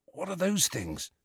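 soft, high, hushed question; whispered. A narrator's reading. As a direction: ask it under the breath. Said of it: "whispered Ron".

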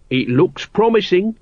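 high-pitched appraising remark; critical. A narrator's reading. Nobby sizing up the archer's pose, critical. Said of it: "he said critically".

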